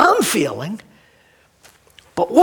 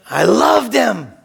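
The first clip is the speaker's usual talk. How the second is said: tearful. Real, not acted. Pastor remembering his grandpa, tearful. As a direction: voice breaking with real tears.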